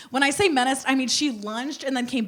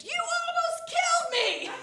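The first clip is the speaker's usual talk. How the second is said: high mock yell through laughter; a hammed-up scream at the driver; high-pitched mock shriek; retold scream; 4 dB quieter than usual. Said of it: "And I screamed".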